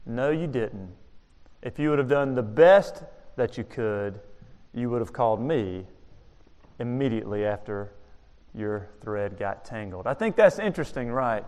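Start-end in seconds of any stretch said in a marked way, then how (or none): 0.1-10.1 low, soft, measured reproof; hushed, deliberate telling-off; quietly stern foreman. Retold or imitated. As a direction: reprove gently but firmly, voice dropped. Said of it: "a little angry".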